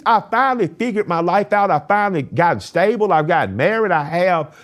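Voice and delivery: high, loud, exasperated ranting; heated rant. Retold or imitated. Ranting at his grandmother in the car, high and loud. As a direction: pour it out like he's fed up. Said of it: "after I went on a rant".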